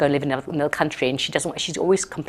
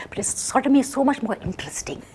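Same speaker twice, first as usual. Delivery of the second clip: a lightly voiced eager judge, higher at the start; lecherous judge; 6 semitones higher than usual. Judge savouring a more interesting case, lightly voiced. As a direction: relish the dirty case, mildly hammed.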